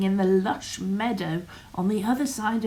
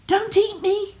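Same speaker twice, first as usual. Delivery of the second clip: higher, louder goat pleading; middle goat voice; 12 semitones higher, 6 dB louder than usual. Acted. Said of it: "he answered".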